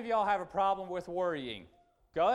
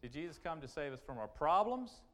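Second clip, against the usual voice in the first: ordinary talk, nothing marked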